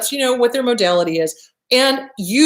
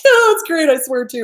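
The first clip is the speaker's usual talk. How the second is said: eager agreement; 5 semitones higher, 4 dB louder than usual. Retold or imitated. Clients answering about swearing.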